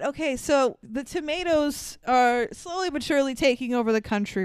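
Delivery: normal talking voice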